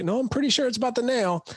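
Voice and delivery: husband shooting back, hammed-up; deadpan husband. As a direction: insist it's the nail in a high mock voice. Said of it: "he's like".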